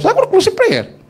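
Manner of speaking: high, loud mock disbelief; hammed-up outraged squeak; incredulous mock. Mocking his own assignment.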